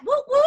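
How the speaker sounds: high, loud "woo woo"; two quick cheering hoots; double whoop. Real, not acted. Host calls for claps on good news, whooping.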